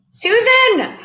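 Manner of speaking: high, loud delighted call; thrilled to see her friend in the chat; excited squeal of a name. Real, not acted. Host spotting a friend in the chat, delighted high call.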